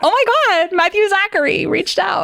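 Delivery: mock fangirl gasp; starstruck.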